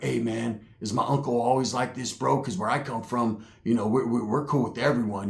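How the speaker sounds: put-on low, hushed young dude; lightly voiced nervous nephew; a hint of a mumbling kid; nephew's voice. Nephew quoted in story.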